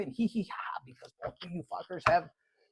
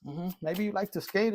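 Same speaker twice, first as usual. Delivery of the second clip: usual speaking voice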